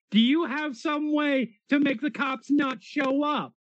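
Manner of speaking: high, loud frantic shout. Acted. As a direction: yell at them to get in. Character shouting in car.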